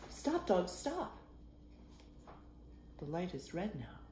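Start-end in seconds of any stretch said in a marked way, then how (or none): 0.1-1.2 calling out. Acted. Calling out to dogs.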